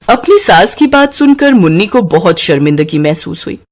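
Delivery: usual speaking voice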